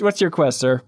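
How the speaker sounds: normal talking voice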